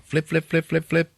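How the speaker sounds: high, quick repeated word; imitating switch flicks. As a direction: chirp it mechanically like a button pressed repeatedly. Imitates rapid switch flipping.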